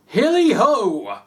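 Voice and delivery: loud, high, drawn-out whoop; a merry old man whooping; jolly cry. A narrator's reading. Fezziwig whoops as he skips down from his desk. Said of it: "cried old Fezziwig".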